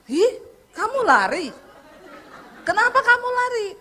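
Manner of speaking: high, loud shocked "kenapa kamu lari"; mock outrage at someone who ran; indignant disbelief. Preacher on phone scolding her tour leader, high indignant surprise.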